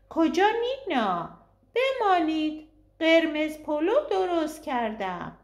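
high, lightly voiced elderly mother; old mother calling. A narrator's reading. Elderly mother urging the guest to stay, lightly voiced and high. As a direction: call out warmly from the window.

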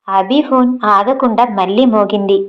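ordinary delivery

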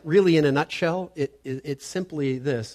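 ordinary talk, nothing marked

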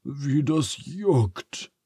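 slow, drawn-out whine about the itch; whining. A narrator's reading. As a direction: moan it slowly, itchy and miserable. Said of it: "jammert Phineas". (Phineas jammert langsam über das Jucken)

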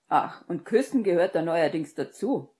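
loud, indignant jibe; worked-up mock question; sarcastic retort. A narrator's reading. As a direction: fling it back scornfully. Sarcastic friend retorts loudly.

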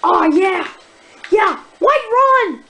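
loud, high, delighted exclamations; building excitement; excited cheer. Real, not acted. Cheering at reaching a town.

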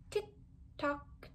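soft unvoiced tick-tock falling away; hushed clock tick; whispered tick-tock. Clock ticking, read aloud.

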